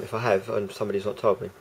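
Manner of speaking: ordinary talk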